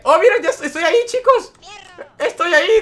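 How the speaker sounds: high, laughing squeal of surprise; excited laughing shout. Real, not acted. Streamer spotting himself in the game, high laughing excitement.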